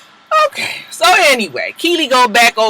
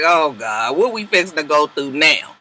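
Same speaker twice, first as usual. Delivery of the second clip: low weary groan voice; 6 semitones lower, 3 dB quieter than usual. Retold or imitated. Sean's look voiced.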